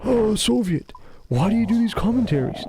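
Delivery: high, whispery imitation of a fan asking; mock viewer question. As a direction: ask it in a breathy fan voice. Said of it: "Why do you guys always ask me".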